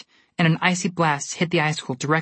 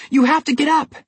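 high, loud urgent shout; urgent yell; 10 semitones higher, 5 dB louder than usual. A narrator's reading. Jack yelling at Goldilocks to get up, high and urgent. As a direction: yell to get her moving. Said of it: "Jack yelled".

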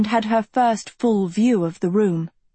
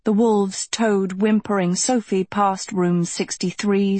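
ordinary delivery, nothing marked

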